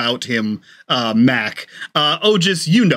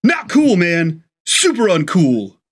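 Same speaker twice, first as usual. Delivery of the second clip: high-pitched indignation easing into sulky reproach; offended protest; 4 semitones higher, slower than usual. Acted. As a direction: complain like a snubbed friend. Friend acts offended at exclusion.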